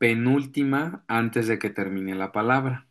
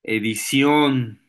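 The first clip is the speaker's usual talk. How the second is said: "edición" with a long, strong final syllable; última sílaba fuerte; slower than usual. Shown as a demonstration. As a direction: slowly punch the end of "edición". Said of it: "que suenen fuerte en su pronunciación". ("edición", alargando con fuerza la última sílaba)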